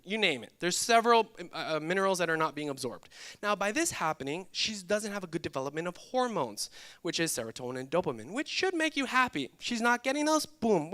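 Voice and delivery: ordinary talk, nothing marked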